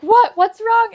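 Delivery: husband alarmed from the kitchen, loud. Retold or imitated. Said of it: "my husband is like".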